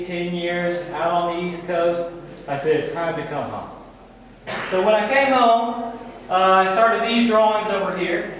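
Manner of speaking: ordinary talk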